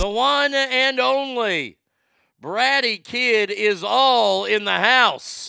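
loud, high, drawn-out announcer call; hype announcer intro. Pretend. Host hails a viewer joining the chat, hype announcer intro. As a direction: stretch out a big ring-announcer intro.

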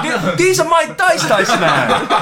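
high, slowed protest through laughter; hammed-up indignant laugh; laughing mock protest. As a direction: plead it while cracking up.

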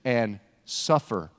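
low and very slow; weighted, word by word, with a pause; grave announcement. Acted. Jesus changing what Messiah means, grave and slow. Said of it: "here's where he changes it".

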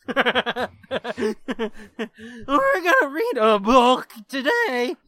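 slow, loud put-on voice; hammed-up voice-breaking teenager; balls just dropped. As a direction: play up puberty hitting mid-sentence. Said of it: "His balls just dropped".

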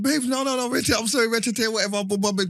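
high-pitched imitation of a pleading girlfriend; whiny-high mock girlfriend plea. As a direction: mimic her begging, up high. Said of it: "she said".

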